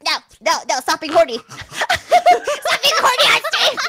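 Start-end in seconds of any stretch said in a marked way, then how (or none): 1.5-3.8 high, drawn-out laughing protest; laughing scolding. Real, not acted. Scolding co-host on stream, laughing helplessly. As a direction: scold while cracking up. Same scolding, now cracking up.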